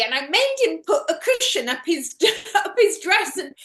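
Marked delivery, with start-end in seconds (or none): none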